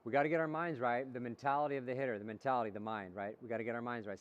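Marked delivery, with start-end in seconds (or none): none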